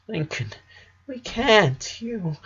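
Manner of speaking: high, soft voice cracking and failing; words choked off by crying; tearful, breaking. Acted. Sister unable to finish through tears, breaking.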